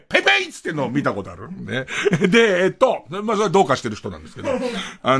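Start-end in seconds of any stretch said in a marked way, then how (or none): 0.1-0.5 high, loud mock shout of the brand name; imitating a customer yelling ペイペイ at the register; loud call of PayPay. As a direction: belt out ペイペイ like that guy at the store. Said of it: "大きな声で肉声で".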